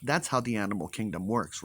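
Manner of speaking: normal talking voice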